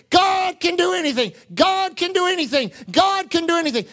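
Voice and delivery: high, repeated exclamation of praise; imitated fervent worshipper. Guy jumps up raising his hands, fervently proclaiming. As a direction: mimic an excited believer, pitched well up. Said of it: "a guy jumped up and started raising his hands".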